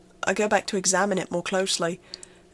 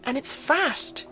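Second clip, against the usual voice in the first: much higher and louder, frantic, building to near-shouting; panicked; 7 semitones higher, 3 dB louder than usual. Acted. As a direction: build from shaky disbelief to full panic. Realises it was there all along.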